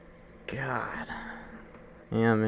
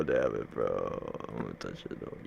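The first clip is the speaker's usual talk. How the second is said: deep voice; 9 dB quieter than usual. Acted. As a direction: growl it way down low. Drops into a very deep voice.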